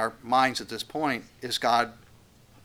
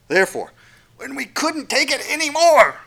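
hint of exasperation; 11 semitones higher, 7 dB louder than usual. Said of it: "hear it in his voice".

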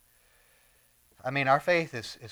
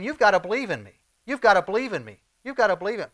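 louder, higher, emphatic plea; insistent urging; 5 semitones higher, 5 dB louder, faster than usual. Acted. Preacher voices Jesus urging followers to believe, insistent. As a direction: press the point as a preacher voicing Jesus.

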